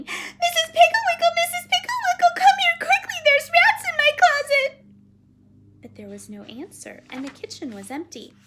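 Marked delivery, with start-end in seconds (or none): 0.0-4.8 very high, loud cry for help; panicked yelling. Acted. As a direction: yell for help like a frightened child. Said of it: "she ran downstairs yelling".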